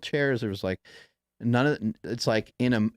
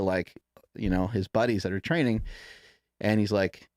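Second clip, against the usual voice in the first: ordinary talk, nothing marked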